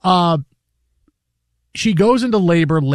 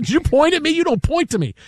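high indignant scold; mock scolding; 11 semitones higher than usual. Wife scolding husband for pointing, high mock indignation. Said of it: "She goes".

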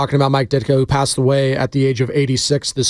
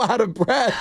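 ordinary talk, nothing marked

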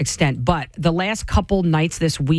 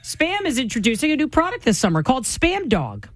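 ad read; 8 semitones higher than usual. Acted. Reads the product announcement.

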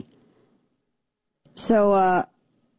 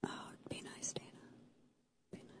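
hushed inner conscience voice; whispered self-warning; 31 dB quieter, much faster than usual. Pretend. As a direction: whisper to yourself. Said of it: "No, I'm not gonna be nice".

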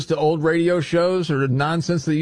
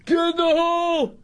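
very high-pitched golf-crowd holler; mock heckler yell. Co-host playing a golf fan showing off to friends, high mock yell. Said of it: "I'm gonna say".

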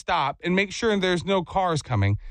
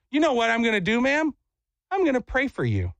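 higher-pitched, pointed retort; mock-sweet punchline; 6 semitones higher than usual. Cyclist drops the mic on the lady, pointedly sweet. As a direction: deliver the mic drop pitched up.

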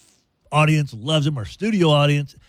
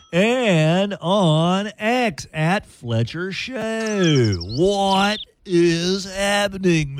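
drawn-out announcer; 4 semitones higher, much slower than usual. Acted. Radio host drawn-out announcer voice.

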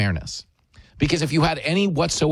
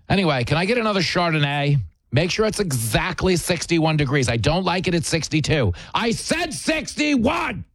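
spoiled diva. Retold or imitated. Mocking the spoiled couple ordering Chardonnay, bratty shout. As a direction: demand your wine, then explode.

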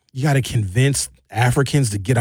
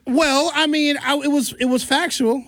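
sheepish high excuse; 9 semitones higher than usual. Retold or imitated.

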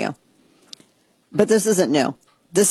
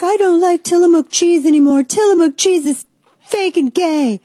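much higher and louder, whiny put-on voice; mocking angry customer; 12 semitones higher, 5 dB louder than usual. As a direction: sneer like an outraged shopper. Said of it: "So people were like".

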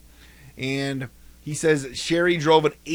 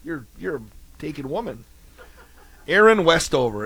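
usual speaking voice

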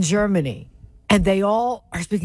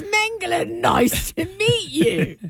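mock British movie villain; 10 semitones higher than usual. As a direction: introduce yourself like a fake-British movie Nazi. Mock movie Nazi introducing himself.

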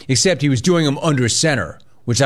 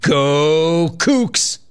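mild mock yell, drawn-out and higher; imitated yell; 4 semitones higher than usual. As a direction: imitate a team cheer, stretching both words. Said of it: "finished the interview by yelling".

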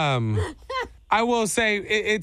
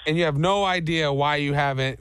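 ordinary talk, nothing marked

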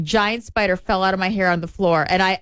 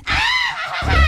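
witch shriek. Acted. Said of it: "Did you hear my witch voice?".

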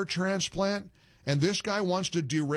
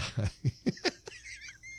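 high laugh; 13 semitones higher, 6 dB quieter than usual. Real, not acted. Host laughing at the caller.

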